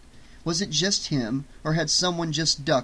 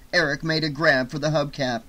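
usual speaking voice

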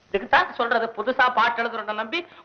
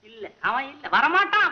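ordinary delivery

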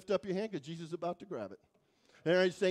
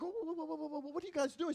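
higher, softer startled "whoa"; alarmed protest; 6 semitones higher, 6 dB quieter, faster than usual. Retold or imitated. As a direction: stop them, caught off guard. Alarmed at paperwork.

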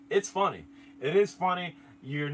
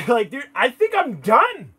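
laughing mock panic; 9 semitones higher, 12 dB louder than usual. Retelling fear of UFO.

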